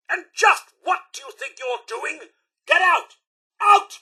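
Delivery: high, outraged ejection order; angry shout. Acted. Guard throwing out an intruder, angry shout.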